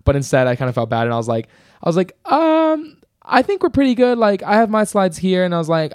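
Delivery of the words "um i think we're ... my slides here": much higher, sweet and hesitant; a nervous high voice, trying to sound helpful; high, overly sweet reassurance to a panicked teammate; high-pitched reassuring. Retold or imitated. Nervous reassuring quote to partner.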